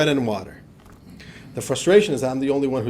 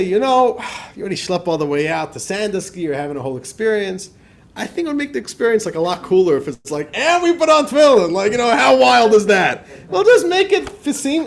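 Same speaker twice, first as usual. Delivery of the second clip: high, loud eager pitch to a stranger; pitching tefillin to a reluctant man, high and eager; 6 semitones higher, 7 dB louder than usual. Retold or imitated. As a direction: sell it with excitement, voice up high. His own retold pitch, raised.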